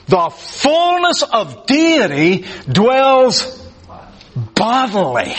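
high, drawn-out marvelling at each word; awed emphasis. Real, not acted. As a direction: dwell on it slowly in amazement. Marvelling at an amazing verse.

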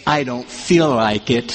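low, slow, sulky protest; reluctant listener. Pretend. A listener objecting to Paul, low and sulky. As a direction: grumble it like you don't feel it. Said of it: "And you're gonna say".

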